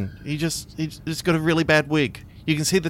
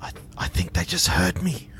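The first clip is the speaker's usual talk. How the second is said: hushed alarm; 2 semitones lower, 5 dB quieter than usual. Acted. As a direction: whisper as if afraid of being overheard. Fears being overheard in vent.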